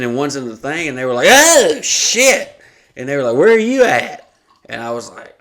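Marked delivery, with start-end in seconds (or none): 1.3-2.6 high, loud shocked squeal; shocked girls. Retold or imitated. 3.4-4.3 high, loud astonished ask; surprised question. Retold or imitated. Girls' quote.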